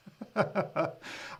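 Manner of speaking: breathy, helpless chuckle; real laugh at someone's remark. Real, not acted. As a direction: chuckle quietly before apologising. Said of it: "I'm laughing at something".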